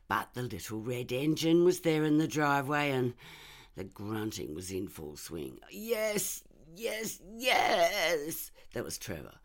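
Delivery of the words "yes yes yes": high, loud breathless cries of pleasure; ecstatic cries. Acted. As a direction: cry out in ecstasy, much higher. Trevor heard during affair.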